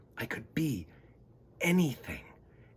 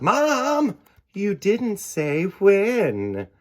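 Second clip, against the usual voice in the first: high, loud, slow drawn-out tease; mom's punchline; 10 semitones higher, 8 dB louder, much slower than usual. Acted. Mom delivering the joke's punchline to her son, slow high tease. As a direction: stretch the punchline out teasingly.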